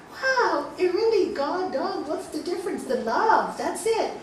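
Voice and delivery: high, wondering exclamation; amazed realization. Retold or imitated. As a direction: marvel aloud at a sudden insight. Reliving her amused realization.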